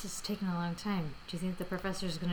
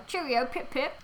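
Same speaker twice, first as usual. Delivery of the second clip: mock British; 4 semitones higher, 3 dB louder than usual. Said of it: "put on accents".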